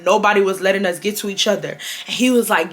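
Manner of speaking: ordinary talk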